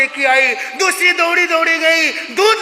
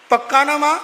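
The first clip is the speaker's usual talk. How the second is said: usual speaking voice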